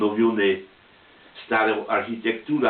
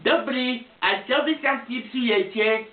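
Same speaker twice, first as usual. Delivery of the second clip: put-on high-pitched little old grandpa; old man voice; 12 semitones higher than usual. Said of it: "taki mały dziadzio pod krycką i w kapelce i padł". (wysoki głos małego dziadka)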